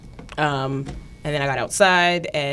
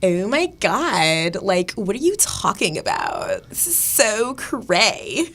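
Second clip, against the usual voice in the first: put-on slow, drawn-out Valley Girl; slower than usual. Shown as a demonstration.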